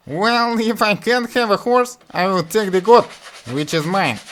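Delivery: high, loud foolish voice; stubborn like the village fool; Butthead voice. Acted. Butthead answers father.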